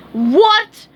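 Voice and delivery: furious boss bellow. A narrator's reading. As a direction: bellow in shock. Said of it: "he bellowed".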